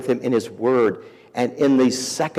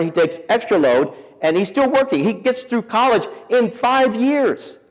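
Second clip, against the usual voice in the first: rising higher and louder with pride; proud building; 10 semitones higher, 5 dB louder than usual. Real, not acted. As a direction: build it up to a triumphant peak. Building proud climax of story.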